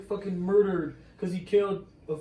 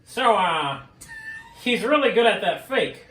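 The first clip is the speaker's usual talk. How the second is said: Tim Ryan; 5 semitones higher, 4 dB louder than usual. Pretend. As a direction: improvise a pitched-up sportscaster impression. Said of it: "your best Tim Ryan voice".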